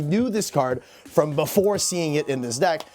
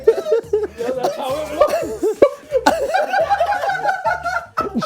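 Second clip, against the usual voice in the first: long, high, helpless laughing fit; hysterical laughter. Real, not acted. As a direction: laugh until you can't stop.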